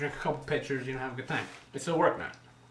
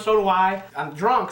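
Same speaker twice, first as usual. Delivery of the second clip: normal talking voice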